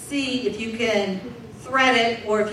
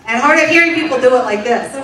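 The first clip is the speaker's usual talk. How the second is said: loud and quick; 2 semitones higher, 9 dB louder, faster than usual. Acted. Comic contrast of clapping styles.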